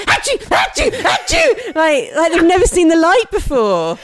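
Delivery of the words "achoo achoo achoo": high loud mock sneezes; big sneeze. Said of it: "they're all like".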